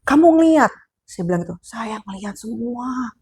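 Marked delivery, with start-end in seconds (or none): 0.1-0.7 mock loud question. Retelling a ghost encounter. 1.7-3.1 softer, very slow, in a hushed voice; hushed slow reply. Retold or imitated. Man tells the storyteller he saw everything, softly and slowly. Quoting the man's eerie reply.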